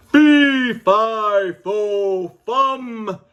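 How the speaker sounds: loud, stomping fee fi fo fum, each word hit hard; giant's roar. Acted. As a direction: boom it out like an angry giant. Giant wakes and roars.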